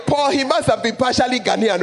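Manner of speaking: normal talking voice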